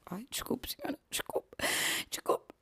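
whispered, flustered apologies; panicked whisper. Retold or imitated. Panicked customer whispering apologies to silent shop lady. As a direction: back away apologising in a whisper. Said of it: "E eu fiquei em pânico".

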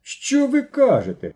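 high, slow cry of disbelief; stunned, crying out in alarm; shocked cry. Acted. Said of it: "закричав Мишко".

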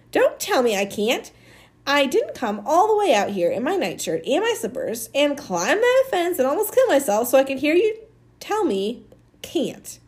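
high-pitched indignant yelling. A narrator's reading. Amanda climbed the fence for Maniac, indignant yelling at his refusal. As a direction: build in outrage, then bite off the last words. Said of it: "she was yelling".

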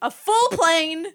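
put-on high, slow old lady. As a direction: stress the awkwardness slowly. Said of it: "imitating an old lady".

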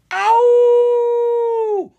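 high, loud wolf howl; long animal howl; werewolf howl. Acted. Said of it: "The howl was louder this time".

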